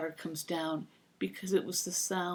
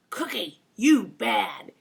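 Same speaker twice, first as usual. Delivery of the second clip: loud mock scold, mimicking me; parrot in my voice; 3 semitones higher, 9 dB louder, much slower than usual. Parrot repeating the caregiver's scold, loud mimic. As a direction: repeat the scold like a talking bird. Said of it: "in my voice".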